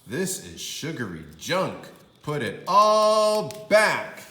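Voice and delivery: firm order to return it; exasperated dad; stern father scolding. Acted. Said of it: "said her father".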